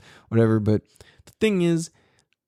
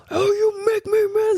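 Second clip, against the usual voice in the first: much higher, whiny, crying mock voice; mock whiny teacher. Mocking a teacher who blames kids, whiny high crying voice. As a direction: whine it like a sulking adult blaming a kid.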